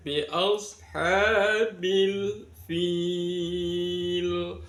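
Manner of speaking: Bi ashabil fil with fil stretched long; long vowel. Shown as a demonstration. Teacher stretching the long vowel for students. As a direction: draw out fil for five beats. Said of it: "Dibaca lima harokat".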